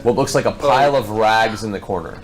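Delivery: usual speaking voice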